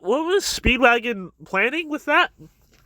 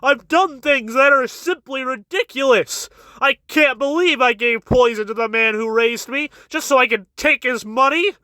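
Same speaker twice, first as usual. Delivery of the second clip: higher-pitched mock-emotional confession; put-on remorseful pleading, pitched up; feigned emotional remorse; 5 semitones higher than usual. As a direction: plead with overdone regret, laying on crocodile tears. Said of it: "he tries to get all emotional".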